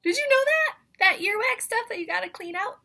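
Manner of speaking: wide-eyed astonished ask; amazed. Real, not acted.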